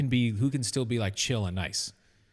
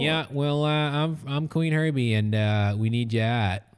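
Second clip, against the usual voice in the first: put-on hammy Maine accent, dropped r's; lightly put-on Maine accent; 2 semitones higher, 4 dB louder than usual. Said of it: "Can I be from Maine?".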